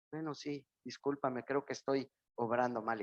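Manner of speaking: sheepish, soft admission of fault; meek apology. Retold or imitated. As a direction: back down and apologize quietly. Angry person now apologizes.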